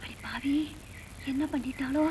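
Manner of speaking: breathless whispered curse; whispered gasp. Acted. Mother whispers a curse, stung by chili powder. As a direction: hiss it under your breath in shock. Burning eyes, gasps curse.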